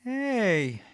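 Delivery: usual speaking voice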